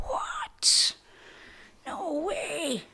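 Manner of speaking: breathy, high "what?" in disbelief; a shocked whisper, not for the nun to hear. Retold or imitated. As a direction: gasp out a stunned "no way" under the breath. Shocked inner reaction.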